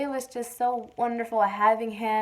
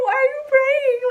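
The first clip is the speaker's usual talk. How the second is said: very high, louder mock dismay; freaked out; 7 dB louder than usual. Labouring woman at praying mother-in-law, freaked-out high mock cry. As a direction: squeal it in exaggerated disbelief.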